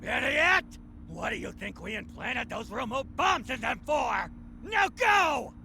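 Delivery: angry scolding. Acted. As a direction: tear into the doctor. Villain berates doctor.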